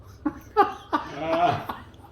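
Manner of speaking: breathless unvoiced laugh; airy laugh. Real, not acted. Laughs at own punchline.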